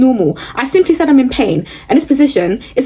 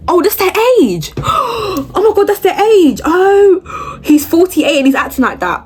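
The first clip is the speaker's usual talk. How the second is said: horrified realisation, voice jumping up; shocked; 9 semitones higher than usual. Real, not acted. Reading his age and reacting in high shock.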